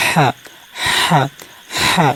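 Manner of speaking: হা breathed from the middle of the throat; throaty ha. Shown as a demonstration. Teacher demonstrates Arabic ha to students. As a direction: push হা from mid-throat. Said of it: "হলকে মধ্যখান থেকে বের করবেন".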